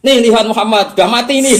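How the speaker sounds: loud, high mock announcement; distorted, provocative expression. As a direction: crow it to the crowd as a caricature. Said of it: "Itu oleh ngekspresi apa omongan Abu Bakar?". (meniru penghasut, lantang dan tinggi)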